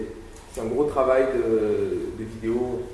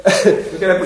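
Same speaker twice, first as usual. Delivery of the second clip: laughing; 6 semitones higher, 8 dB louder than usual. Real, not acted. Laughing through the clip.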